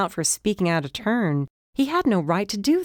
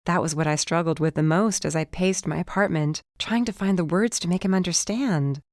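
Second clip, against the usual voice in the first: ordinary delivery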